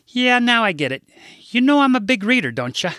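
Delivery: a mild hint of a prim, fussy secretary; lightly played prim office manager; older secretary. Narrator voices Rose.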